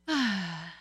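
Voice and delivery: long audible sigh. Real, not acted. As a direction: let out a long, falling sigh. Disclosing after judgement of parents.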